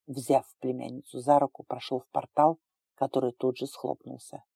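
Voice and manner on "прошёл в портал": usual speaking voice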